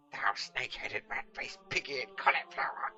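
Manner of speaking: slow, loud outraged bellow; furious curse. Acted. Catweasel locked in the cupboard curses Pickle, loud slow fury. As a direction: curse him with full fury. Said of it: "continued Catweasel".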